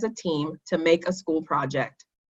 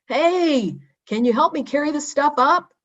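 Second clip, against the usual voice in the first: much higher, calling up to the treehouse; calling from below; 8 semitones higher than usual. Acted. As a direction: call up to friends above for help. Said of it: "called a voice from below".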